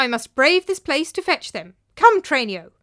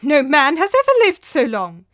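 loud, raised, scoffing protest; sputtering disbelief; 5 semitones higher, 10 dB louder than usual. Acted. Master disputes the ghost's lifespan, incredulous. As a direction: object as if it's preposterous. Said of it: "sputtered Theopropides".

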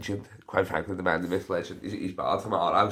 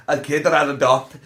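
suddenly loud, high and excited; loud excited burst; 7 semitones higher, 10 dB louder than usual. Real, not acted. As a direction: blurt it out at full volume.

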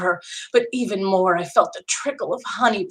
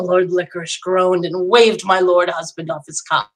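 plain reading voice

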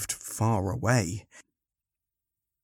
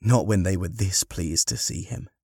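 ordinary delivery

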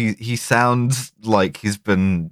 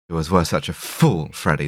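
lightly voiced scheming woman from a detective film; evil voice; 6 semitones lower than usual. Scheming woman unmasked by the detective, lightly put-on evil voice. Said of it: "The evil voice".

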